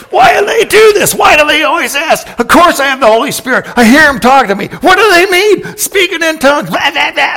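shouted high, loud complaint; angry rant. Retold or imitated. Past self ranting about tongues question, loud and angry. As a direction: rave about it like you used to. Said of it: "I'd just go crazy and rant and rave".

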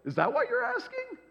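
high, sarcastic feigned shock; mock surprise. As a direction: gasp then tease them, pitch way up.

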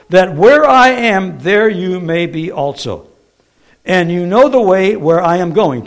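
loud, stretched, emphatic Bible reading; scripture reading. Acted. Jesus promising his disciples a place, emphatic scripture reading. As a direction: proclaim the verse slowly and firmly.

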